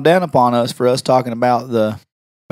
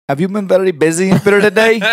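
higher, put-on caller voice; prank caller voice; 6 semitones higher, 4 dB louder than usual. Shown as a demonstration. Revealing the prank to the receptionist, caller voice. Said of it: "Why can you talk like, exactly like that".

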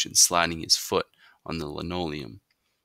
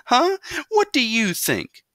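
high huh, then breathy, held-back laugh; laugh creeping in; 7 dB louder, slower than usual. A narrator's reading. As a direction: try not to laugh while scolding. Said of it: "A little laugh was starting to get into Mama's voice".

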